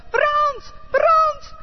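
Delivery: high frightened shout of fire; screaming. Acted. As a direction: scream for help as the house burns. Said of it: "schreeuwde ze".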